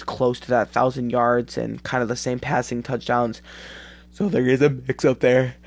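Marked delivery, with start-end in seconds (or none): 4.1-5.6 yawning. Real, not acted. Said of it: "I'm sorry that I'm yawning".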